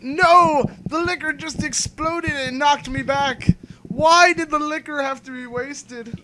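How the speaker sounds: high, loud mock lament; switching impression voices. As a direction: wail it like a hammy drunk. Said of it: "And then you're like".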